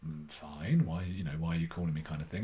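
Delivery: wary mum doubting the caller; suspicious mum. Retold or imitated. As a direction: sound like a mum who smells something odd.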